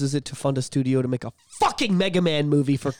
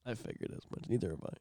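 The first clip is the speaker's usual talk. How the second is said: soft whispered aside; 6 semitones lower, 16 dB quieter, faster than usual. Real, not acted. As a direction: whisper it knowingly. Unvoiced aside.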